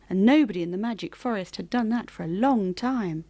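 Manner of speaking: usual speaking voice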